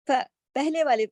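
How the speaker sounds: ordinary delivery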